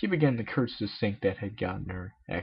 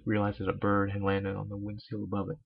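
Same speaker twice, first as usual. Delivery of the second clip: ordinary delivery, nothing marked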